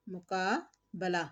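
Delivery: मुकाबला slowly, a light zabar "ba-la"; slow correct pronunciation. Shown as a demonstration. Teacher slowly models मुकाबला for students. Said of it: "ज़बर की थोड़ी सी आवाज़ निकालेंगे".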